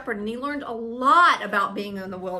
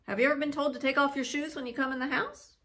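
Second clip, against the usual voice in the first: ordinary delivery, nothing marked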